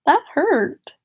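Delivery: a high, sharp little ow of retold pain; replaying the stomach cramp with a squeaky ow; mock pain yelp. Telling viewers about a sudden cramp, high mock ow. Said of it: "I was like".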